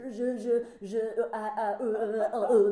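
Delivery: babbling like an idiot, hammed-up; tongue-tied stammer. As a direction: choke on the first syllable, over and over. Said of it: "讲得像笨蛋一样".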